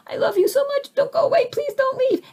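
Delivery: very high, small begging voice; tiny pleading voice. Retold or imitated. Inner light begging loved one to stay, tiny high plea. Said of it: "little Christ light that's in you going".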